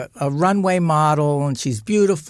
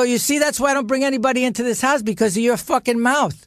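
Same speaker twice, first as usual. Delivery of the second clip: fast, higher, heated outburst; son snapping at mother; 7 semitones higher, faster than usual. Retold or imitated. Son yells at his mother in front of girlfriend, fast and heated. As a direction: blurt it out in a rush of anger. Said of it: "I go".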